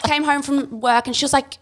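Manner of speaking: usual speaking voice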